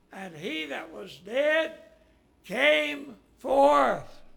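slow, rising to a high, loud cry; preacher's proclamation. A narrator's reading. Preacher telling the congregation of Lazarus rising, ringing proclamation. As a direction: proclaim it, stretching the last words out. Climax of raising Lazarus story.

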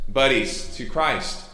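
normal talking voice

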